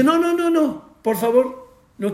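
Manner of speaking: high, urgent pleading refusal. Retold or imitated. Woman begging the rabbi's wife not at home, high pleading. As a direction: beg her not to make you come.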